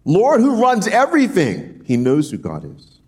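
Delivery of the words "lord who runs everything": high, slow pleading cry. Retold or imitated. Amos crying out to the sovereign Lord, high and slow. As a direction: call out to God, drawn out and pitched up. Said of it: "he cries out again".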